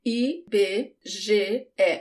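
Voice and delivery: slow, separated Portuguese letter names; "I B G E" spaced out as ee, beh, zheh, eh. Shown as a demonstration. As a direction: read out each letter slowly, one at a time. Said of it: "That's the sound of each of the letters".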